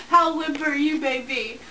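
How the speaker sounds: ordinary talk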